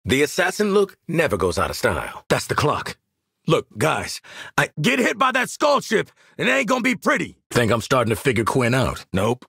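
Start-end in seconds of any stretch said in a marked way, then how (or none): 4.6-7.4 high, raised combat voice; urgent warning. Acted. Warning squad of incoming fire, urgent callout. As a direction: warn them over the battle.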